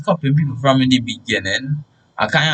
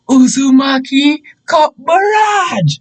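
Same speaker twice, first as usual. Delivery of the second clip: high, drawn-out attack-name shout; high-pitched war cry, stretched at the end; battle cry; 11 semitones higher, 4 dB louder, slower than usual. Retold or imitated. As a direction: call the attack out like a cartoon finishing move. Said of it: "then I hear the famous words".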